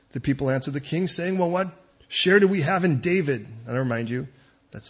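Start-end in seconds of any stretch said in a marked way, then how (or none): 1.4-3.5 loud, raised-pitch challenge; defiant retort. Acted. The people answering the king who ignored them, defiant. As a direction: throw the question back at the ruler.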